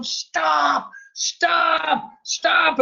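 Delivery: high mock yell of stop; imitating someone shouting from the stairs; retold yelling. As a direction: call out stop, half-hearted yell. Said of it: "somebody started yelling at me".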